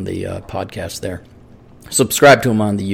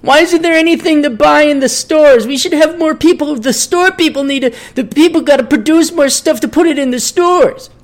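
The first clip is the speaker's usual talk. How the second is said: entitled millennial demanding free crap, high whine; whiny millennial. Retold or imitated. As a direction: whine like a spoiled kid wanting free stuff. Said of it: "And then the millennials like".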